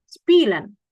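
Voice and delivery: "spielen" with hissed S onset; ordinary S. Shown as a demonstration. Teacher contrasting two words, plain S in "spielen". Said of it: "yang satu S".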